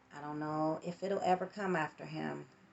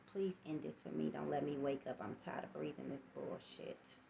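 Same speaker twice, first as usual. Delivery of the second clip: quiet begging; 4 semitones lower, 13 dB quieter than usual. Retold or imitated. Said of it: "when I was begging to please end it".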